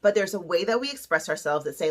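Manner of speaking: usual speaking voice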